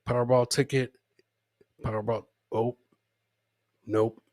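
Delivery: ordinary talk, nothing marked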